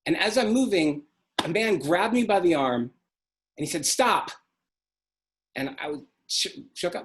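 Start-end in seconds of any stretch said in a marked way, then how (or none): 3.9-4.3 authoritative. Retold or imitated. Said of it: "he had such authority".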